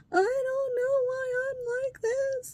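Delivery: high, drawn-out imitation wail; mocking pity-party voice; mock crying. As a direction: whine it like a kid starting to cry. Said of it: "he starts crying".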